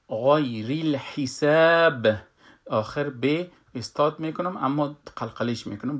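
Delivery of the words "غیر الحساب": غیر الحساب slow with a thick gha and light hi; heavy ghain recitation. Shown as a demonstration.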